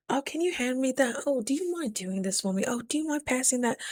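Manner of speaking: sweetly asking a small favour; polite request. Acted. Acts out making polite small requests.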